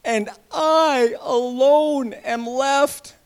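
loud, slow lament to God; despairing prayer. Acted. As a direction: cry out alone and defeated, slowly. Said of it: "he says".

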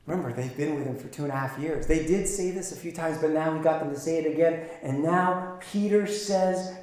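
ordinary delivery

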